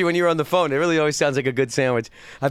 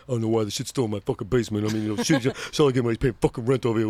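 a low, soft put-on tough-guy grumble; lightly voiced tough guy, low; 7 semitones lower, 3 dB quieter, faster than usual. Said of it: "he was a real tough guy".

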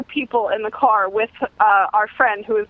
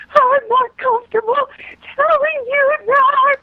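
put-on high girly voice; 12 semitones higher than usual. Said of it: "And my friend goes".

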